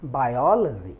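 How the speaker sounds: बायोलॉजी with stress on यो; model pronunciation. Shown as a demonstration. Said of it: "इसी तरीके से हम बायोलॉजी पढ़ेंगे". (सही उच्चारण)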